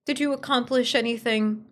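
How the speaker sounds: normal talking voice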